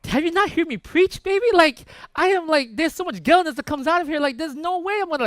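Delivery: loud, high, worked-up protest; freaking out. Retold or imitated. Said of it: "I was freaking out".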